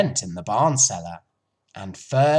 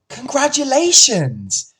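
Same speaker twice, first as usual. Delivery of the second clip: high, loud excited shout; Wilbur shouting; 12 semitones higher, 8 dB louder than usual. A narrator's reading. Wilbur shouting congratulations to the goose, excited shout. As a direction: burst out with joy. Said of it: "shouted Wilbur".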